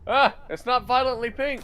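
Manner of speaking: screeching, very loud and high; high-pitched shriek. Real, not acted. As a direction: shriek it in outrage. Shrieks after being shot.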